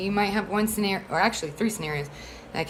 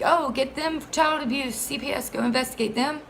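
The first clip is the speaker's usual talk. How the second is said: higher, eager tattling voice; mock the accuser egging CPS on; mocking quote; 6 semitones higher than usual. Mocking a false accuser urging CPS, higher eager mock voice. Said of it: "that person's like".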